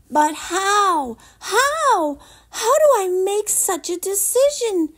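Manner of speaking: high, loud anguished cries; despairing wail. Acted. Boy alone before the wedding, wailing loudly in despair. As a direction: howl out the despair. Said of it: "Jameson sank in despair".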